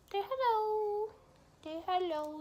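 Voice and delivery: high pet-talk to a needy cat. Real, not acted. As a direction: coax the cat to greet people. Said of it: "my cat's right here".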